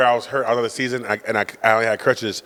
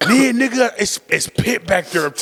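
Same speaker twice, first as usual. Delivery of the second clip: insistent dad; 10 semitones higher, slower than usual. Retold or imitated.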